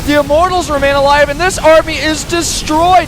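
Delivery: high-pitched, thrilled battle call; excited play-by-play. Real, not acted. Caster watches the Colossi fall to Void Rays, excited play-by-play. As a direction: ride the fight with soaring excitement.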